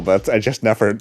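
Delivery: normal talking voice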